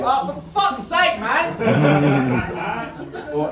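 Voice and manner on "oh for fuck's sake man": exasperated quizmaster. Retold or imitated. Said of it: "and you'd hear".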